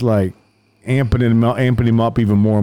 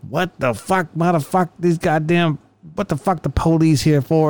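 higher put-on voice of a riled-up ex-con; drunk suspect yelling; 6 semitones higher than usual. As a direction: squawk it like an outraged little guy. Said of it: "he starts going".

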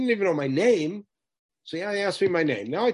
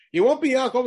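dramatic proclamation; 8 semitones higher, 6 dB louder than usual. Acted. Teacher acts angel's dramatic renaming.

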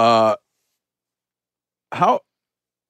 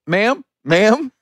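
calling out; 9 semitones higher than usual. Retold or imitated. Calling out to lady in dark.

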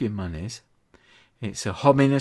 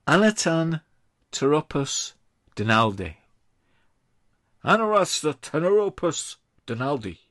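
high pompous "Anarasta Teropus Donaldi"; mock-grand Latin; 5 semitones higher than usual. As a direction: declaim the fake Latin. Grand quiz reading.

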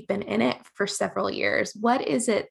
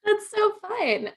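excited; 10 semitones higher than usual. Real, not acted. Delighted reaction.

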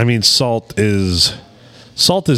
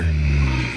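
low hum of mock pleasure; mock pleasured moan; 8 semitones lower, 4 dB quieter than usual. Caller thinking of garlic butter, low mock-aroused moan.

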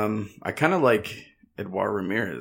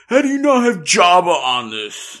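mock outrage; 7 semitones higher, 7 dB louder than usual. Fans complaining, mock outrage.

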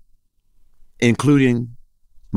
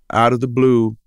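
usual speaking voice

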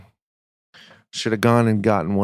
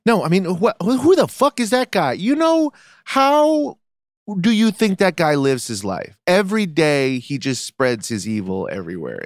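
intense outrage; 7 semitones higher than usual. Real, not acted. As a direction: get riled up, climbing higher. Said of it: "You're intense, man".

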